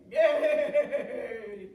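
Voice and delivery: loud, shuddering whinny; whinny like a horse, long and loud; horse neigh. A narrator's reading. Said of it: "of course, said".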